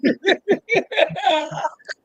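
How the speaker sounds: high-pitched laugh; long, high laugh at a touching moment; emotional laugh. Real, not acted. Laughs amid tearful moment.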